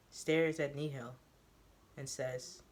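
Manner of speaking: ordinary delivery, nothing marked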